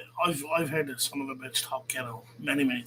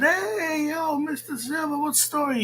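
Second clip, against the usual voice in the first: high-pitched, drawn-out "hey, yo" greeting; re-enacted hail; 10 semitones higher than usual. Re-enacts hailing someone on a call.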